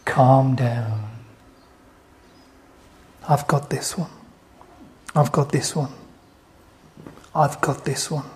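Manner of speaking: hushed voice of God taking charge; calming reassurance. Acted. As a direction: settle them down quietly, pausing between phrases. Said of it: "Is God saying".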